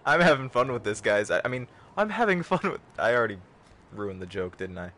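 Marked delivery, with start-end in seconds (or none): 2.0-2.8 high British butler voice; British butler accent. Pretend. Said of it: "breaking into my regular voice".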